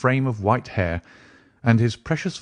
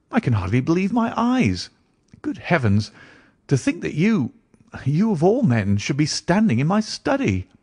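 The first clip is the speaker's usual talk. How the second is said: high, swooping exclamations of wonder; amazed disbelief; 7 semitones higher than usual. Acted. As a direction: marvel at a friend back from the dead. Watson amazed Holmes is alive.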